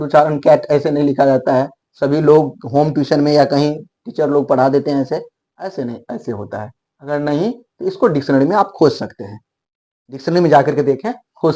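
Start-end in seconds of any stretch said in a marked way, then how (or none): none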